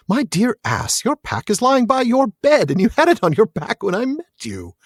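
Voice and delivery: high, exasperated scolding; teasing hobbit voice. A narrator's reading. Reader voices Merry chiding Pippin, high teasing hobbit voice. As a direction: chide a dear friend in a lifted hobbit voice.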